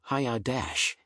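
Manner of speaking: plain reading voice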